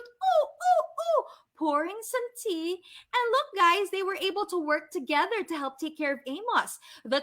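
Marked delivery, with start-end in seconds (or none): 0.0-1.2 high, loud playful owl hooting. Acted. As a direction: hoot like a cute little owl. The owl pouring tea.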